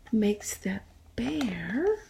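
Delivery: slow whisper, holding back; hushed build-up before the sneeze; suspense whisper. Acted.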